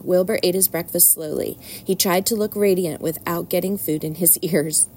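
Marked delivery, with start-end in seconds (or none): none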